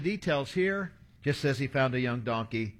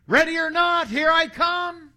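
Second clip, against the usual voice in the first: hide-and-seek call; 12 semitones higher, 5 dB louder than usual. Retold or imitated. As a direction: call out high like the seeker in a game. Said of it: "listening for someone to say".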